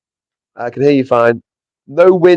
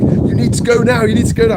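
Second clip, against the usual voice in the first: hurried official; 9 semitones higher than usual. Retold or imitated. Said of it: "he's like".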